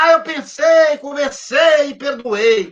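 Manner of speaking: mock smug claim. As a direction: play someone proudly claiming they've already forgiven. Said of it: "a pessoa fala".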